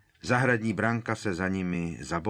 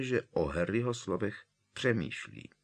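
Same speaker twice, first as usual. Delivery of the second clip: ordinary delivery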